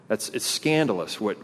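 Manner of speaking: ordinary delivery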